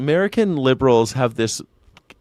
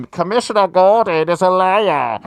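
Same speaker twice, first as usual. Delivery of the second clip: loud, higher, gravelly caped-hero parody; mock Batman growl; 6 semitones higher, 6 dB louder than usual. Mocking Nolan's dark hero, loud gravelly parody. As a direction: bark it like a brooding Batman.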